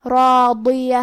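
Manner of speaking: راضیہ with heavy ر and ض; heavy letters. Shown as a demonstration. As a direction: give راضیہ weight on ر and ض. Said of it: "بھاری آواز میں پڑھنا ہے".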